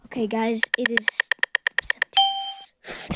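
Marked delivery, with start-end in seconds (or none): 1.9-3.2 drawn-out high. Real, not acted.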